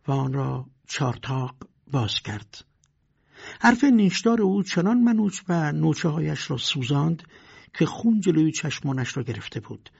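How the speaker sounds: ordinary delivery, nothing marked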